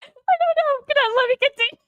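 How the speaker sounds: high, breathless giggling; can't stop laughing mid-sentence. Real, not acted. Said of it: "Can you stop laughing first?".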